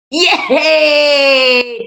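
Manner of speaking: a bright shouted yay for the right answer; cheer. Real, not acted. Cheering a correct quiz answer.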